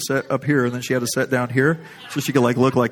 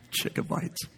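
wheezed through laughter; 12 dB quieter, slower than usual. Real, not acted. Laughing at his own story.

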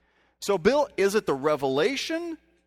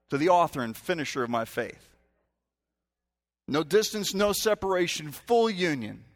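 normal talking voice

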